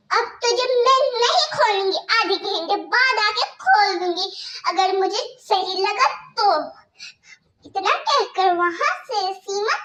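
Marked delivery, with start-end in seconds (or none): 0.1-6.5 harsh stepmother scolding. Acted. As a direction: bark orders at the girl like a cruel stepmother.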